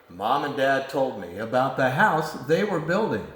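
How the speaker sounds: ordinary delivery, nothing marked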